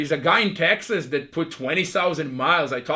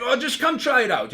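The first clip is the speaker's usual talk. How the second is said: lightly imitated Russian accent, pitched higher; 8 semitones higher than usual. Hockey coach inviting an eight-year-old to try out, light Russian accent. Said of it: "thick Russian accent".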